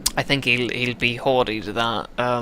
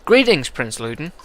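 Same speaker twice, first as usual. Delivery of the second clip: ordinary talk, nothing marked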